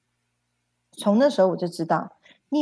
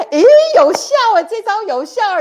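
much higher and far louder, thrilled; excited discovery; 13 semitones higher, 13 dB louder than usual. Retold or imitated. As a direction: cry out as if the trick just worked. Discovering the chanting works.

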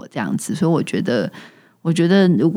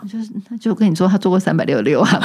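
usual speaking voice

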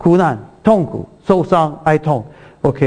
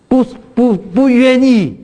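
不愿意 drawn out, higher and slower; emphatic denial; 8 semitones higher, slower than usual. Real, not acted. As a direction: lean hard on 不愿意, stretching it. Pastor stresses God's unwillingness. (加重拉长"不愿意")

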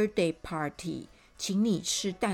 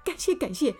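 higher, louder, rising stream of thanks; gushing thanks; 6 semitones higher, 4 dB louder than usual. Acted. Mr. Perfect thanks his guests while opening presents, gushing. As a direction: thank everyone over and over, eagerly. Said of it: "一边呢是满嘴的感谢".

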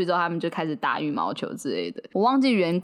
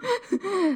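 short high laugh; 13 semitones higher than usual. Real, not acted. Laughing at partner's remark.

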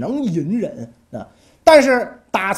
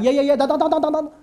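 startled; 8 semitones higher, much faster than usual. Acted. King startled by weeping minister.

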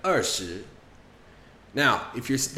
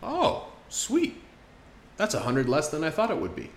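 "Oh, sweet" jumping much higher, pleased; pleasantly surprised; 5 semitones higher than usual. Acted. Guy pleased to hear the PlayStation costs less, surprised. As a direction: react like the price beats your guess.

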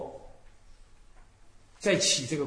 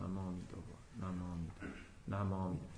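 low, very soft Buddha-name chant; hushed, low devotional murmur; slow settled recitation; 9 semitones lower, 14 dB quieter, much faster than usual. Shown as a demonstration. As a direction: recite inwardly, barely voiced, letting the mind settle. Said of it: "慢慢念，心就沉下来".

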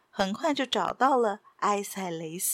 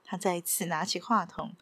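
plain reading voice

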